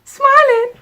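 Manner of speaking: sudden high, loud squeal; giddy high squeal of joy; squealed laugh. Real, not acted. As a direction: burst out in a joyful squeal. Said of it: "Now I'm preaching".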